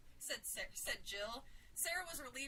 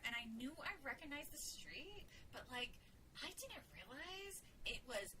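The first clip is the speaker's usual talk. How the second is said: hushed, confidential tone; softly confiding to a friend; lowered voice; 6 dB quieter than usual. A narrator's reading. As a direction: keep it down so a child can't overhear. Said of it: "lowered her voice while saying".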